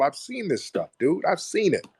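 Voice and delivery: ordinary delivery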